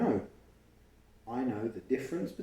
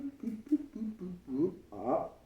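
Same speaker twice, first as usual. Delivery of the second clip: boop sounds; 7 semitones higher than usual. Acted. Tracing dots on the page.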